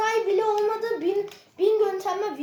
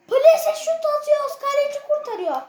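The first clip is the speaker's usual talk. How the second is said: exasperated shout; 7 semitones higher, 4 dB louder than usual. Real, not acted. Placed shot saved again.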